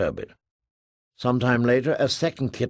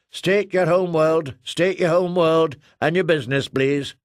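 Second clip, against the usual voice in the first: higher, louder, insistent demand; officious control officer; 5 semitones higher, 3 dB louder than usual. Acted. Namor Control demanding name and business, insistent official. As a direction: repeat the challenge like a wary controller. Round-faced woman repeats demand.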